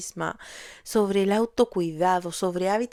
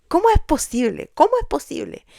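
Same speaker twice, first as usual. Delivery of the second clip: higher, louder pleading cry; anguished raised voice asking why; desperate outcry; 8 semitones higher, 6 dB louder than usual. Retold or imitated. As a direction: cry it out in despair. Despairing outburst recalling her crisis.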